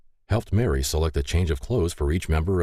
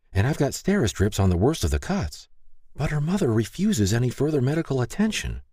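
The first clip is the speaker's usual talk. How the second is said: lightly voiced medic, pitched higher; concerned medic; 8 semitones higher than usual. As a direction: report the injuries in a higher character voice. Narrator voices Tess reporting on patients.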